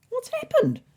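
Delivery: high, startled question; a sudden worried ask; alarmed. Acted. Weasley startled by the stricken cabinet, asking high and alarmed.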